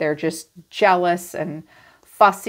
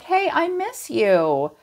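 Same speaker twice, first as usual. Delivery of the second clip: high, slow, pleading "miss you" voice; drawn-out needy sigh of a line; mock clingy ex; 8 semitones higher, slower than usual. An ex pining for the Sagittarius, played as a sappy plea. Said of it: "they're like".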